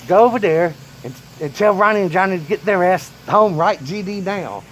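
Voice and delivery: loud, high angry order; grandpa ordering. Retold or imitated. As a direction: bark the order at the boy. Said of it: "He's like".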